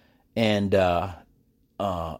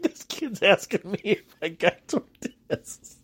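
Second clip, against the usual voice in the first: slow, amused, still chuckling; teacher laughing; 9 semitones higher, 3 dB quieter, slower than usual. Retold or imitated. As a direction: say it slowly through leftover laughter. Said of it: "She laughed so hard".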